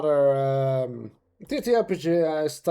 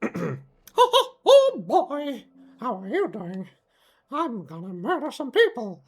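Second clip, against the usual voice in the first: high, chuckling character with wild swoops; character voice demo; 5 semitones higher than usual. Shown as a demonstration. Showing off a voice range to viewers, gleeful villain voice. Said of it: "voices I can do, um, a range from".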